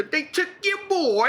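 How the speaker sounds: high mock gangster line; quoting the British gangster, high bad British accent. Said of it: "that deals that voice".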